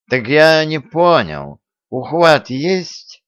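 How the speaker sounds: put-on insist, slurring drunk. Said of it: "повторил он вопрос".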